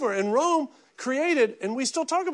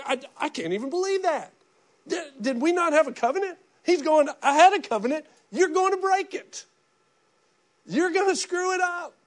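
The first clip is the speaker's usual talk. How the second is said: higher, mock-indignant divine voice; a light, hammed-up take on an offended God; mock wounded God; 5 semitones higher than usual. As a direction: play God as pretend-shocked and hurt. God voiced, hurt by broken covenant.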